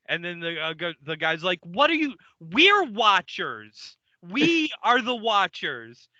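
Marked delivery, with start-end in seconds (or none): 1.6-6.1 slow, drawn-out exasperation; indignant correction. Acted. Watcher correcting Bill on who they are, indignant. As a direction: stretch out the key words, incredulous.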